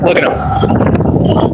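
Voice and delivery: slow breathy whisper to the camera; whispered aside. Real, not acted. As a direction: hush it out like a secret. Aside about onlookers on livestream.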